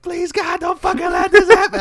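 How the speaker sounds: high, loud, desperate crying prayer; imitating a panicked woman's tearful shout to God; mock frantic praying. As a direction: shriek it like a terrified sobbing passenger. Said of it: "bawling her eyes out".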